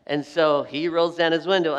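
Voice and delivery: ordinary talk, nothing marked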